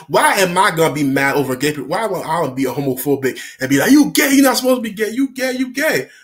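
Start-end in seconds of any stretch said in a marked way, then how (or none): 3.9-6.1 high, quick mock accusation; mock scolding. Storyteller mimics a homophobe, mock scolding. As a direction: heckle like a hammed-up bigot.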